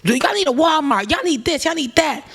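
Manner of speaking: high pushy mock yell. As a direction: bark it like a rude tourist. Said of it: "yelling at the server".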